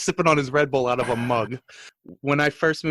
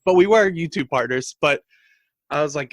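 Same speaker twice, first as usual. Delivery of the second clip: ordinary talk, nothing marked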